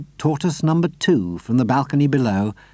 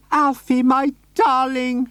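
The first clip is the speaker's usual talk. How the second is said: much higher, slow, doting lady's voice; Missus Silver crying out; 13 semitones higher, slower than usual. A narrator's reading. Missus Silver greeting her tortoise, high and doting. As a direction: coo over the tortoise lovingly. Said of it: "she cried out".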